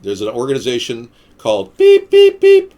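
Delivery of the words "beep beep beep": very high, loud spoken bleeps; imitated censor beep. Shown as a demonstration. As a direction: bleep out the name in a piercingly high voice.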